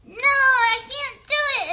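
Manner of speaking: a little girl replaying her own tantrum loudly; reenacted tantrum. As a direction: yell it like a kid giving up on a craft. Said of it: "I had a little tantrum and I went like this".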